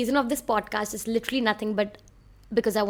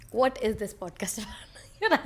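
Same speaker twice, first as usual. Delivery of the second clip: whispered through a laugh; giggly whisper. Real, not acted. Asking her own podcast's premise, whispered with a laugh. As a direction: ask it again in a laughing hush.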